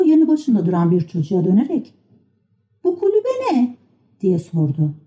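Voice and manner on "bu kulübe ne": very high-pitched little puppet voice; Pinokyo. A narrator's reading. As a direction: ask in a high childlike pitch. Pinokyo çocuğa soru soruyor. (tiz kukla çocuk sesi)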